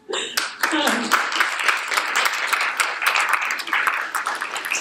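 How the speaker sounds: long breathy laugh of relief; happy laugh. Real, not acted. Laughing with the congregation after announcing her pregnancy, relieved.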